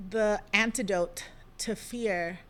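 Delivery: normal talking voice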